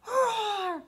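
loud, high playful roar; mock dinosaur roar. Shown as a demonstration. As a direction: roar again for the kids. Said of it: "One more time".

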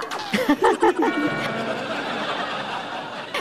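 high-pitched laugh trailing off; laughter. Real, not acted. Woman teases her date about arriving earlier, laughter. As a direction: burst of high laughter, fading away.

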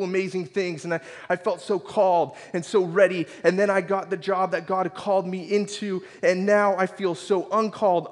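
impassioned retold prayer; anguished plea. Desperate written prayer poured out to God.

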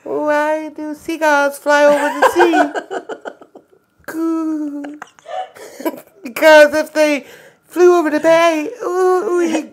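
high-pitched baby imitation. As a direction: squeak it out like a babbling infant. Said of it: "Read the joke in a baby's voice".

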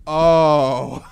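imitating anime characters stunned silent. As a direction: let the realisation land in one syllable. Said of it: "their jaws just drop".

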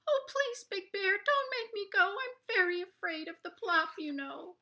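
very high, tiny frightened plea; pleading little rabbit. Acted. Littlest rabbit begging the bear not to make him go, very high. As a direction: beg like a scared little bunny.

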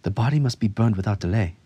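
a brisk, commanding healer's verdict; firm declaration. A narrator's reading. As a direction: declare it fast, leaving no room for argument. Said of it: "Anseth declared".

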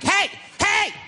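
high-pitched mock yell; two high, urgent calls; urgent calling out. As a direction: call out high to stop someone. Man runs up shouting warning.

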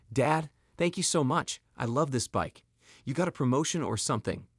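ordinary delivery, nothing marked

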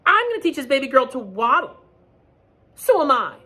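high and loud, one-upping; one-up each other like birds; competing birds. Acted.

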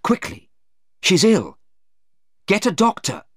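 high, loud panicked call for help; urgent alarm. A narrator's reading. Mother calls urgently for a doctor.

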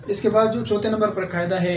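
ordinary talk

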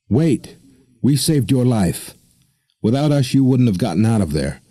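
a woman pleads with the mariner not to leave, desperate. Acted. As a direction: clutch at him and beg him to wait. Woman grabs his ankle pleading.

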